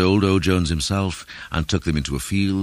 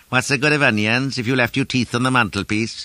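high, loud grumbling question; raised voice of a grumbler; querulous character voice; 8 semitones higher, 5 dB louder than usual. A narrator's reading. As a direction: complain in a raised, peevish voice. Narrator voices Mister Weasley's complaint.

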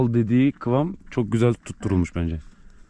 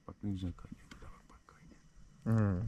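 whispering; 2 semitones lower, 15 dB quieter than usual. Real, not acted. Watching coffee boil, hushed.

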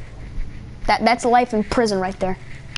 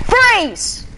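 cop shout; 10 semitones higher than usual. Pretend. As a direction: bark it like a cop. Cop roleplay ordering freeze.

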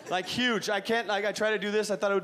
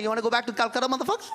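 1 Indian dad threatening; faster than usual. Retold or imitated. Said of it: "the dad's like".

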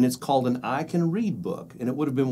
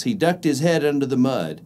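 usual speaking voice

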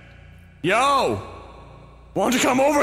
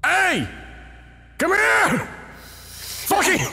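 angry shout; 6 semitones higher, slower than usual. Real, not acted. Yelling at monster.

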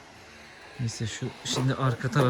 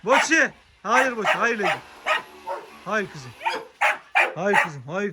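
much higher, sing-song no to the dogs; high scolding of dogs; 7 semitones higher than usual. Real, not acted. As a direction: tell the dogs no, playfully.

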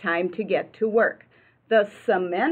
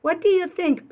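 high eager question; a hint of an excited boy; 9 semitones higher, 4 dB louder than usual. Pete showing workers his plans, high and eager.

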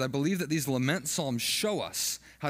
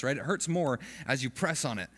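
ordinary delivery

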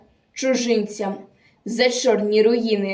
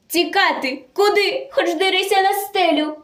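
high, urgent theatrical alarm; frightened panic; 7 semitones higher than usual. Acted. German jumps from bed as partisans attack, frightened panic. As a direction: blurt it in acted panic, pitch jumping up. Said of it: "Наляканий німець схопився з постелі".

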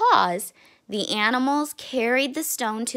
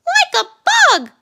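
put-on very high, loud little-kid squeal; echoing cry; 12 dB louder than usual. A narrator's reading. Ronnie echoes his brother's warning, squealing loudly. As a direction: squeal it as an excited echo. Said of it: "echoed Ronnie".

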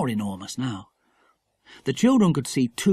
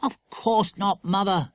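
scornful; 6 semitones higher, slower than usual. A narrator's reading. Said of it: "said Philip scornfully".

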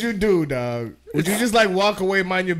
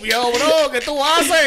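loud, higher Puerto Rican Spanish; Puerto Rican accent; 6 semitones higher, 5 dB louder, slower than usual. Pretend. Friend stops buddy in Puerto Rican Spanish, loud. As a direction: confront him Boricua style. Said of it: "how would you say it in Puerto Rican".

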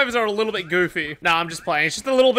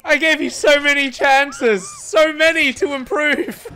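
higher, slow, drawn-out lament; mock despair; 5 semitones higher, 3 dB louder, slower than usual. Killer player finishing off survivor after many chances, mock despair. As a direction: wail it like a let-down coach.

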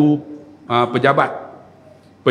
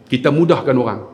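ordinary delivery

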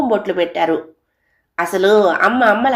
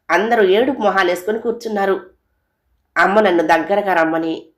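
plain reading voice

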